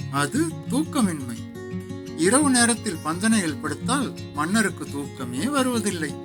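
ordinary delivery

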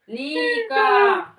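loud, high, drawn-out syllables; loud demo. Shown as a demonstration. Teacher shows Кирил how loud to read, loud and drawn out. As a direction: call it out syllable by syllable. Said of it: "Дивись, у мене громко".

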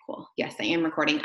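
ordinary talk, nothing marked

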